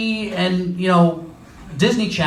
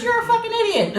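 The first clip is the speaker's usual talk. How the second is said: put-on insulting old man. Old man yelling from car.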